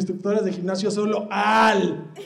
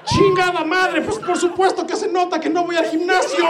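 exasperated outburst; 10 semitones higher than usual. Real, not acted. Outburst at heckler.

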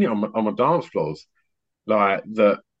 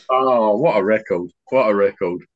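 ordinary talk

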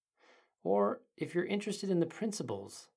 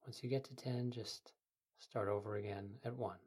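soft, quiet meditation guide; sleep story narrator; 5 dB quieter than usual. Acted. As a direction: ease the listener into calm.

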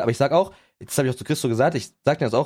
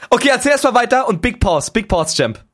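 hoch und laut herausgeschrien; aufgeregter Aufschrei; 12 semitones higher, 8 dB louder than usual. Real, not acted. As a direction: platzt vor Aufregung laut heraus. Erinnert sich plötzlich an Karneval. (lauter, hoher, aufgeregter Aufschrei)

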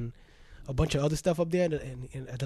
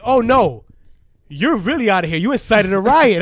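loud official verdict, louder than his own talk; stern review board; 3 semitones higher, 9 dB louder than usual. Retold or imitated. School review board expelling him, loud and stern. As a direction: hand down the expulsion firmly.

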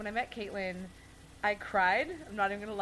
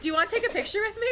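high, fast, chirpy invitation; bubbly offer; 12 semitones higher, 5 dB louder, much faster than usual. Retold or imitated. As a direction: rush the offer cheerfully. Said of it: "she's like".

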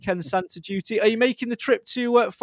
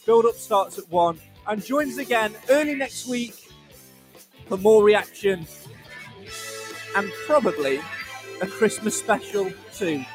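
big, high radio sign-off voice; announcer outro; 3 semitones higher than usual. Acted. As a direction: sell the sign-off like a trailer. Show outro over music.

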